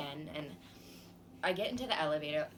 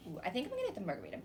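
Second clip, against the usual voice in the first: usual speaking voice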